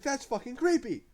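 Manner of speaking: high, squeaky mock-creepy voice; jokey high-pitched shiver at the scene; creepy falsetto. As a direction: squeak it out like a spooked kid. Mock creepy reaction to horror scene.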